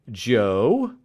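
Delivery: curious master probing the name; inquiring. A narrator's reading. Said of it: "said Mister Spence inquiringly".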